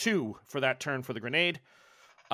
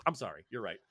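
ordinary delivery

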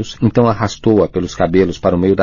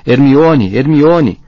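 loud high repeated cries; desperate yelling; 6 semitones higher, 6 dB louder, much slower than usual. A narrator's reading. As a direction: scream her name over and over. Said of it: "Rony tornou a berrar". (Rony gritando pela amiga)

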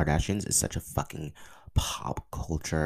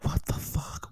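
normal talking voice

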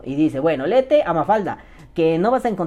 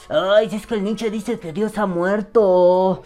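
slow, higher, whiny pompous teacher voice; mocking philosophy teacher; 5 semitones higher, slower than usual. High school teacher lecturing on Nietzsche, slow whiny mockery. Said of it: "Así de".

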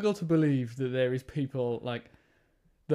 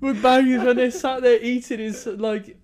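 laughing; 8 semitones higher, 4 dB louder than usual. Real, not acted. Explaining through laughter.

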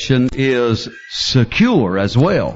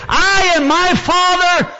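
excited preacher; exclaimed scripture; slower than usual. Acted. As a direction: ring it out.

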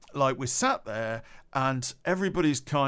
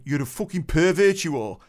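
angry insult; 3 semitones higher, 6 dB louder than usual. Retold or imitated. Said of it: "the guy got up and went".

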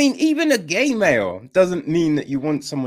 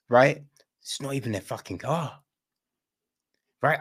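normal talking voice

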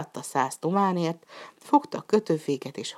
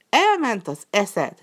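slow, loud and higher outburst; shout; 6 semitones higher, 5 dB louder, slower than usual. Acted. Master to his slave about the vanished mule, slow loud shout. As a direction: roar it at him in disbelief. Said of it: "kiáltott a gazdája".

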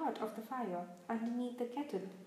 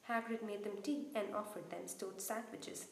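usual speaking voice

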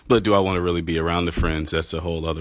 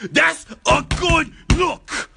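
shrieking, very high and slow; screaming; 3 dB louder, much slower than usual. Pretend. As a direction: scream it out to startle the listener. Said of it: "Hopefully I scared you right now".

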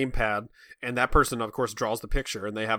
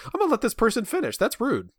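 put-on prim little girl; 10 semitones higher than usual. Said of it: "in her mind, it was like".

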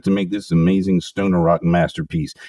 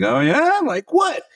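higher, rising imitated "oh yeah? like what?"; mock surprise; slower than usual. Host retelling how he teased his cohost, mock surprised. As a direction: play up the curiosity, teasing. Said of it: "And I was like".

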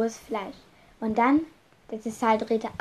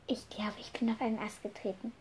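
whisper; 11 dB quieter than usual. Acted. Maria stepped on branch, hiding.

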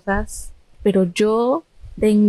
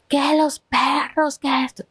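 mock yelling; 4 semitones higher, slower than usual. Patient's mother ranting about the dogs, mock yell. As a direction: imitate a ranting mother without really shouting. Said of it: "mi mamá está gritando".

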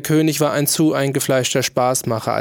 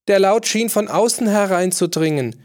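much higher courtier's voice; a fawning courtier chiming in; 8 semitones higher, 3 dB louder than usual. A narrator's reading. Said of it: "bemerkte einer der Höflinge".

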